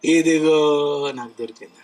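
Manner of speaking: low, lightly voiced smug believer; mock believer. Preacher mocks a believer showing God, low and smug. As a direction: murmur it like a proud believer showing off. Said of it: "చూపించి, చూపించి".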